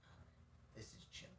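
very soft, airy sigh of relief; barely voiced, acting out relaxation; contented murmur. Imagining a moment with a cigar, contented murmur.

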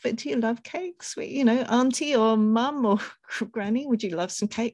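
high, lilting sample question; reflecting it back. Pretend. Niece asking granny whether she loves cake, high reflective questioning. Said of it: "maybe even reflecting it back".

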